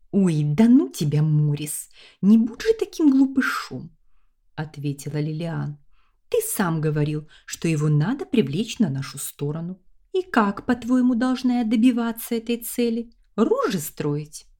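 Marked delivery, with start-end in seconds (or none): 0.1-3.9 high, teasing woman's voice; playful female character. A narrator's reading. Lilian voiced in narration. 6.3-14.5 high, teasing woman's voice; playful female character. A narrator's reading. Lilian voiced in narration.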